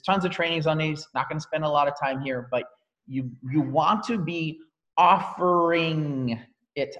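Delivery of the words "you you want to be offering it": slow, weighted "offering it", each word drawn out; stress on offering. Shown as a demonstration. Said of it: "I'm emphasizing that word specifically".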